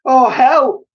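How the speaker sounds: very high, loud scream for help; terrified scream. A narrator's reading. Missus Twit feeling giddy, very high terrified scream. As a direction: scream in terror. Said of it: "screamed Missus Twit".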